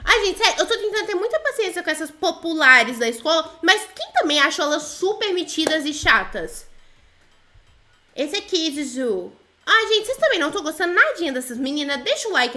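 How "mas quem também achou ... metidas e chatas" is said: normal talking voice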